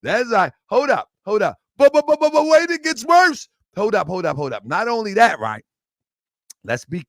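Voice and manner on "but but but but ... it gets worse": high, rapid stammer on the first word; mock stutter. Telling viewers there is more to come, high mock stammer. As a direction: stutter urgently into the reveal.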